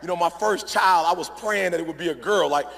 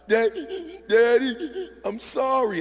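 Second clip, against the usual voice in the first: sobbing little girl imitation, higher pitched; imitated crying; 6 semitones higher, slower than usual. Daughter apologizing to her dad, imitated crying. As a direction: choke out the words between mock sobs. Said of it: "my girl's crying, like, she's like".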